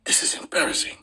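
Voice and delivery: breathy hushed admission; whispered aside. Real, not acted. Streamer caught out by his wife, whispering. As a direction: whisper it sheepishly.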